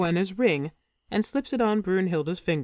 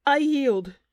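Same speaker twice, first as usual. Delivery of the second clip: narrated scream; 7 semitones higher, 3 dB louder than usual. A narrator's reading. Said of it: "she screams".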